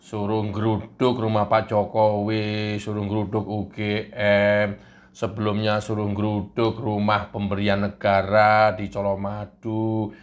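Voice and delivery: low, sulky complaining voice; low, sulky complaint to the devil; low grumbling Kurnia. Acted.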